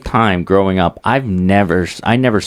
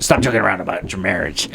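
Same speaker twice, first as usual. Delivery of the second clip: mom scolding; 3 semitones lower, 5 dB quieter, faster than usual. Retold or imitated. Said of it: "my mom would say that to my sister".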